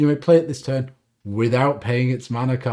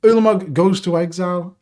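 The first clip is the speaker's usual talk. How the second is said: normal talking voice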